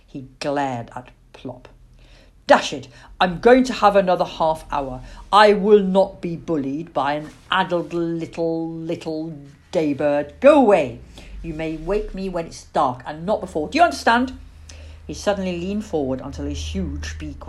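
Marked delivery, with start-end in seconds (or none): 2.5-14.4 blustering, loud scolding from a woken father; irritable dad laying down the law; grumpy father owl. A narrator's reading. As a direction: tell off the child with indignant bluster.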